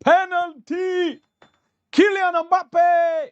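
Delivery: high, slow, drawn-out commentator cry; commentator call. Retold or imitated. Mimicking the broadcast commentator on the penalty, high and drawn out.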